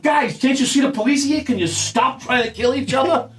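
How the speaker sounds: mock-pleading cop; exasperated appeal. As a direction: appeal to squabbling gunmen in disbelief. Acting out officers addressing the fighters.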